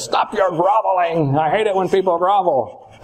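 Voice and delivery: a hint of a cranky old man telling someone off; irritable God. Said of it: "pictured as this real irritable, kind of ornery old man".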